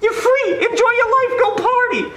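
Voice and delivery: very high-pitched, jubilant exhortation; excited urging. Acted. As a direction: cheer him on as if he'd just been set free. Officer urges husband to celebrate.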